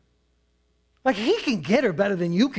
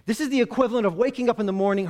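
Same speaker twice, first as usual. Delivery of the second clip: usual speaking voice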